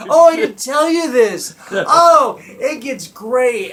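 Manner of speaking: high, loud gushing quote; overexcited driver. Retold or imitated. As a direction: gush it out like a thrilled oversharer.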